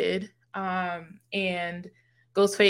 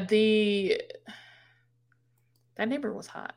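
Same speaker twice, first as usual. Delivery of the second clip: normal talking voice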